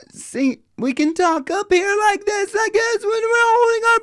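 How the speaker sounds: very high voice on held breath; breath-held high talk. Shown as a demonstration. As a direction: talk way up high with the lungs packed full. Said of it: "holding our breath in, like that".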